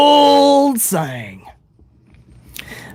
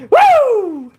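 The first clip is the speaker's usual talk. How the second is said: high falling woo; whoop; 14 semitones higher than usual. Pretend. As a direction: let out a drawn-out whoop. Whoop after the laugh.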